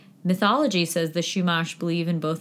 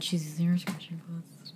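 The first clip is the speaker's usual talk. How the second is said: unvoiced whispered aside; whispering; 11 dB quieter than usual. Real, not acted. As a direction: whisper it under your breath. Cat scratching post aside.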